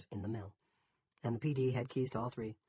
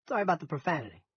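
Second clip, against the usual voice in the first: loud, higher Yankee farmer; apologetic Yankee voice, pitched up; thick Yankee accent; 7 semitones higher, 8 dB louder, faster than usual. A narrator's reading. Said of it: "In his thick Yankee accent".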